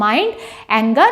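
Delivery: very slow, spelling out each word; slow dictation. Shown as a demonstration. Teacher dictates the rubric to students, very slow. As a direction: read it out slowly so students can write.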